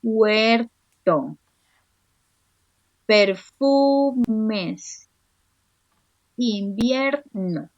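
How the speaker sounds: Marchitas drawn out very slowly; slow stretched word. Shown as a demonstration. Teacher slowly reading the last word of a verse. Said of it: "solo necesito la última palabra".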